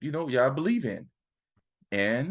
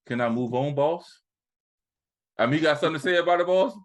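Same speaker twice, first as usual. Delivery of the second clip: louder, faster needling challenge; challenging; 4 semitones higher, 4 dB louder, faster than usual. Real, not acted. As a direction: dare him to speak up. Challenging the co-host loudly.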